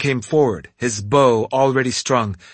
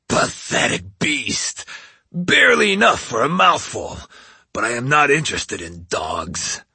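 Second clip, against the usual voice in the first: demon voice. Acted. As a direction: sneer it like a giant demon. Oni speaks to dog.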